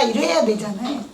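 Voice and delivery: usual speaking voice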